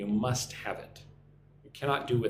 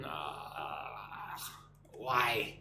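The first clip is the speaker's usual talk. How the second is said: whisper; 4 semitones lower, 7 dB quieter than usual. Real, not acted. Stream froze.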